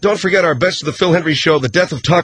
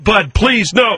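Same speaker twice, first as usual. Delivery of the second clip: higher, urgent plea; pleading; 5 semitones higher, slower than usual. Acted. Host begs distraught sidekick, pleading. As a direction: beg him to stop.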